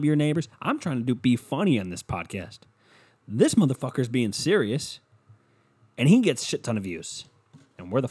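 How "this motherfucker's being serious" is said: ordinary talk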